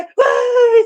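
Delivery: quejido de llanto agudo y exagerado; llanto fingido. Acted. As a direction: suelta un lamento fingido, más agudo y fuerte. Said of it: "por qué está llorando". (llanto fingido y exagerado)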